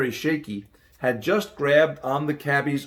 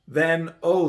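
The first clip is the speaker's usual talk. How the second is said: plain reading voice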